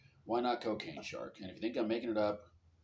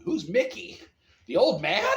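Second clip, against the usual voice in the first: high, loud disbelief; mock shocked; 10 semitones higher, 11 dB louder, slower than usual. Friend asking Rocky about Mickey, hammed-up shock. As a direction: climb into a scandalised yelp.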